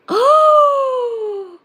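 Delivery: high loud gasp; surprised gasp. Real, not acted. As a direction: gasp in delight. Sees herself in glasses.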